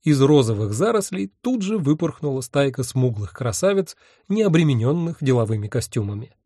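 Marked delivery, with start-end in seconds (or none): none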